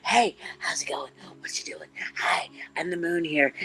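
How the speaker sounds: close, breathy whisper; the near moon whispering hello. Pretend. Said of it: "up here saying".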